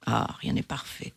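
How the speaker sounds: a soft, whispered sigh of disappointment. Acted. The fox, let down that the planet has no hens, sighing softly. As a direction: breathe it out, resigned. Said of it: "soupira le renard".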